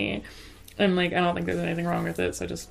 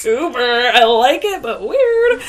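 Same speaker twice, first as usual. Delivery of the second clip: amused judging; 4 semitones higher, 7 dB louder, much slower than usual. Retold or imitated. Retelling judging a goofy boy.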